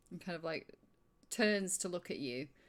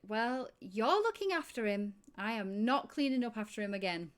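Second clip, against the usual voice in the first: irritated barmaid; 4 semitones higher, 4 dB louder than usual. Acted. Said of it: "And she's like".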